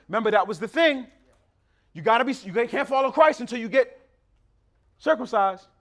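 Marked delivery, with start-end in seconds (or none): none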